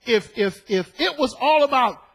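ordinary talk

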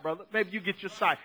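ordinary talk

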